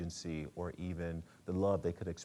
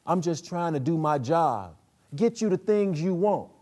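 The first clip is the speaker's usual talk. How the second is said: loud, much higher defiant man's voice; King; 9 semitones higher, 13 dB louder than usual. Acted. King defending himself to his girlfriend, loud and defiant. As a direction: insist hard that you'll keep on living.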